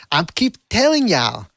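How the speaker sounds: high-pitched, drawn-out mimicry of his cadence; mimicking a father-trainer. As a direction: stretch out TELLING in a mocking singsong. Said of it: "the intonation and the cadence".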